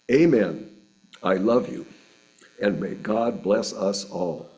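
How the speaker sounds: ordinary delivery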